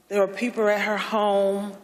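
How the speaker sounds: ordinary delivery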